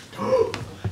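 sharp high frightened gasp; startled gasp. Real, not acted. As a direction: suck in air in fright. Ghost hunt, something happens.

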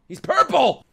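high, loud outburst of disbelief; astonished shout. Real, not acted. Viewer shocked at the purple character, astonished shout. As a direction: cry out in shock.

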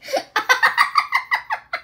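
squeaky high-pitched giggle. Real, not acted.

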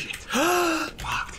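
sharp high gasp; quick startled intake of breath. Real, not acted. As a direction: suck in air suddenly. Sudden reaction while searching.

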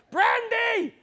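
put-on very high, loud girl's yell; shrieked, impatient young girl; exasperated shout. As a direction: scream the order at your clueless parents. Girl orders parents to offer brandy.

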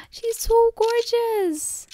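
high, slow, gasping admiration; awestruck. Real, not acted. Player admiring her bride, awestruck gasp. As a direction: marvel at her slowly.

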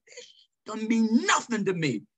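high, loud mock challenge to a fight; imitated drill sergeant challenge. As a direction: call him out like an angry sergeant. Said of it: "He said".

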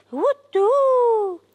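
high, drawn-out imitated hoot; long storybook owl call. A narrator's reading. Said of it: "The old owl hooted".

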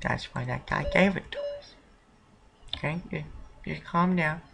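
calm him down in a near whisper; hushed reassurance sliding to whispered words; soothing hush. Acted.

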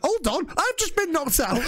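high-pitched retold outcry, laughing; high, amused mock indignation; mock sudden realisation. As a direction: blurt it as a delayed realisation, about to laugh.

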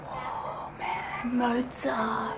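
whispered, amazed "whoa, man, Mozart"; awed baby voice. Retold or imitated. Voicing the baby hearing Mozart, in a whisper. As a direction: breathe it out in hushed wonder. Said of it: "now he's just like".

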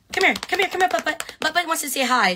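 high sing-song calling to a dog; pet talk. Real, not acted. Calling her dog Butters over on the live stream, high baby talk.

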